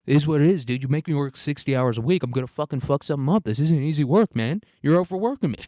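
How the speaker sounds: acted indignant complaint; defensive protest. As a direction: push back at the bosses, worked up. [name]'s acted rant at his employers.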